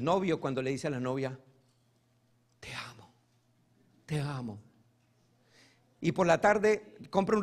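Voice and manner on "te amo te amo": mock whispered love. Mocking a boyfriend's empty love words.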